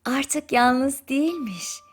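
plain reading voice

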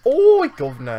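high, loud fake Cockney call; mock Cockney shout. Gamer yelling at a crab in fake Cockney, loud. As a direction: bellow it like a cartoon Cockney. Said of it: "gonna be awful fucking accents".

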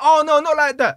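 high, loud mock panic; alarmed denial. Storyteller backtracking to the dad about his daughter, loud high mock alarm. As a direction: blurt a hasty, hammed-up denial. Hastily reassuring the girl's father.

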